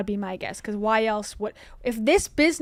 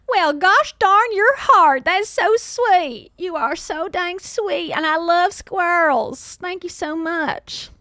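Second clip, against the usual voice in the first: high, slow, gushing delight; gushing thanks; 6 semitones higher, 5 dB louder, slower than usual. Real, not acted. Streamer gushing to a kind viewer, high and slow. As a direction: melt over the sweet message. Sweet reaction to donor message.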